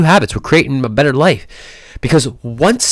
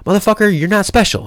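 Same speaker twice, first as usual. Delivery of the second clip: self-scolding; 8 semitones higher than usual. Retold or imitated. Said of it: "this is what I say to myself".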